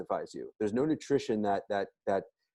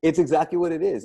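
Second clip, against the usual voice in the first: laughing; 6 semitones higher, 5 dB louder than usual. Real, not acted. Laughing over daddy juice joke.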